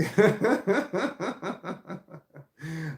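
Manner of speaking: bursts of high laughter. Real, not acted. Laughing at own sarcasm, high loud laugh.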